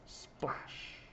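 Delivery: whispered water sound effect; whispered splash. A narrator's reading. Narrator imitating a boy's dive, whispering. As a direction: hush the word into a splashing noise.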